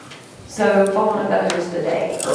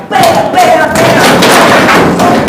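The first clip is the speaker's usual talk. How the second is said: very high, very loud yelled bams; shouted blows; 11 semitones higher, 13 dB louder, much slower than usual. Retold or imitated. Witness acting out blows on her son, shouting. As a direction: yell each hit out at full force.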